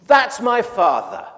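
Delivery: loud, slow, high cry of recognition; joyful shout. Retold or imitated. Little girl spotting her father at the airport, joyful shout. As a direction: call it out with pure delight. Preacher repeats the child's cry.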